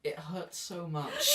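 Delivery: put-on slow, hurting child voice; pained whimper. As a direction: whimper it out in pain.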